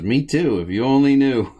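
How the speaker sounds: a hint of coy Curly teasing back. Curly answers Pierre's honeymoon surprise, lightly voiced coy Curly. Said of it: "And Curly says".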